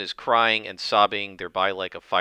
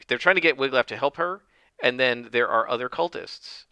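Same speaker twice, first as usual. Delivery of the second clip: ordinary talk, nothing marked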